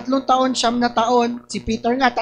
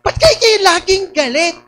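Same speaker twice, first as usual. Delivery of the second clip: mock high, louder question; imitating asker; 7 semitones higher, 5 dB louder than usual. Quoting someone who always asks why they are angry, high and loud. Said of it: "tinatanong niyo sa akin".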